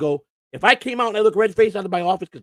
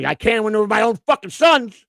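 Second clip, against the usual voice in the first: angry retort; 6 semitones higher, 6 dB louder than usual. Retold or imitated. Snapping back at complaining player.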